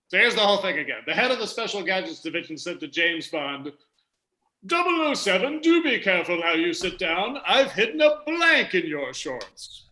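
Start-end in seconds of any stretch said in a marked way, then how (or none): 4.7-9.8 put-on British accent. Host repeats the quiz line for confused panelists, British accent. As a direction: play a British gadget chief, stretching the last line. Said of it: "I'm doing an accent for the purposes".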